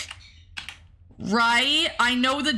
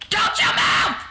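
shouted warning; 11 semitones higher, 4 dB louder than usual. Real, not acted. Yelling at the bird again.

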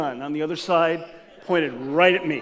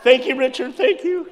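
much higher, laughing relief; gushing thanks; 12 semitones higher, 5 dB louder than usual. Retold or imitated. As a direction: squeal your gratitude. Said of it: "I'm cowering behind him".

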